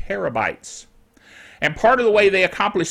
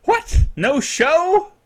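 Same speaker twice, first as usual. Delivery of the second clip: mock surprise; 8 semitones higher, much slower than usual. Mock disbelief at no show.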